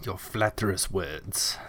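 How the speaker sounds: soft, slow mock-regal queen; playful hushed queen impression. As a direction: murmur it slowly as a flattered queen. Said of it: "the queen is like".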